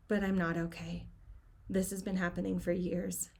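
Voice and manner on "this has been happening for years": plain reading voice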